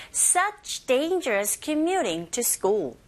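slow, evenly stretched English phrase; slow pronunciation model. Shown as a demonstration. Teacher leads repeat-after-me practice, slow and drawn out. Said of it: "자, 해볼게요".

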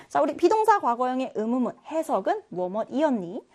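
normal talking voice

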